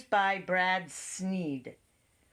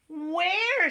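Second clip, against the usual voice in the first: high, louder question; mare asking; 10 semitones higher, 4 dB louder than usual. A narrator's reading. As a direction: call it out high. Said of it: "said the mare".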